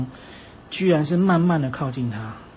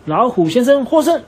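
much higher, louder ringside call; referee announcement; 9 semitones higher, 7 dB louder than usual. Acted. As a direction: announce the winner to the crowd. (裁判大声宣布获胜)

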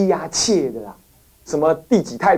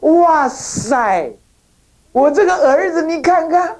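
amazed father; 8 semitones higher, slower than usual. Retold or imitated. Emperor marvels at frugal son.

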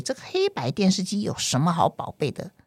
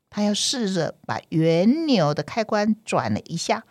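plain reading voice

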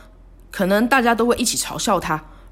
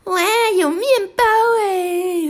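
high, whiny mock-childish voice; mocking sarcastic tone; 12 semitones higher than usual. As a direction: sneer it out in a mocking squeal. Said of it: "故意阴阳怪气的说". (阴阳怪气地尖声嘲讽)

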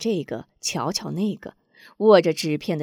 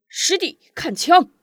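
much higher, loud battle cry; shout; 10 semitones higher, 7 dB louder than usual. A narrator's reading. Ai Wu attacks his junior, shouting. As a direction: hurl the challenge with a spear thrust. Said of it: "喊声".

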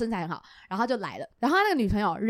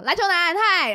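bright mock hello, higher; cheery greeting; 7 semitones higher, 5 dB louder than usual. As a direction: sing out a friendly hi. S0 greets basketball guy cheerfully.

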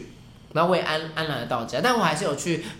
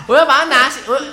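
ordinary talk

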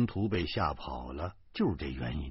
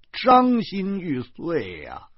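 slow, louder, hammed-up lament; mock lament; 7 semitones higher, 5 dB louder, much slower than usual. A narrator's reading. Narrator mock-lamenting his fate, slow and hammed-up. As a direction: wail it slowly to the heavens. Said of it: "仰天长叹".

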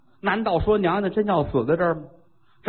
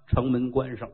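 ordinary delivery

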